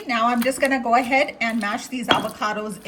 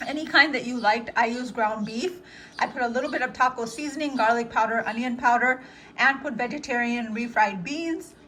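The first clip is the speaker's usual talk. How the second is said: ordinary delivery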